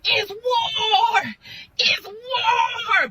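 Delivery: high, crying shout of fury; hollering. Retold or imitated. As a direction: holler a battle cry down the phone. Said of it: "I couldn't do no but holler".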